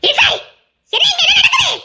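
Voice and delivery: bursting in, demanding to know; mother storming in, shocked. Acted.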